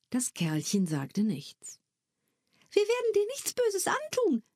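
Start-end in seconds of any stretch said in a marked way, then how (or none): 2.7-4.4 put-on a much higher, gentle girl's voice; girl reassuring a shy tiny being; encouraging girl. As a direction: coax the little creature not to be afraid. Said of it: "ermunterte ihn Nella Della".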